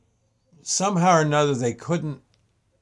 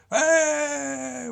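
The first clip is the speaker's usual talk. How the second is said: put-on kids cheering.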